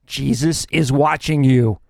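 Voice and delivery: slow, low and eerie voice from the dark; like an unseen voice warning a thief; mysterious voice. A narrator's reading. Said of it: "he hears a voice saying".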